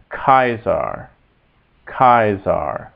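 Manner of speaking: "Kaiser" as KAI-sar, hard K and the vowel of aisle; slow, careful Latin "Kaiser", opening with a K sound. Shown as a demonstration. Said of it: "the C is always hard in Latin".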